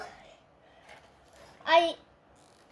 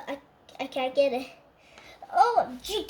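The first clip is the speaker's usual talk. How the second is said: usual speaking voice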